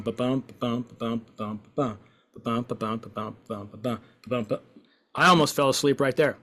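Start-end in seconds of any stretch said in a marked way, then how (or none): 0.0-4.6 soft, even "ba-bum" that drones; monotone metronome. Shown as a demonstration. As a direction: tick it off like a dull metronome. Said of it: "like a metronome".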